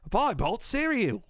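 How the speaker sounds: high mock British ad line; a put-on British pitchman. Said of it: "a British person says".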